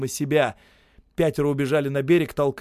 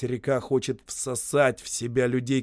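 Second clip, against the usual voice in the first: ordinary delivery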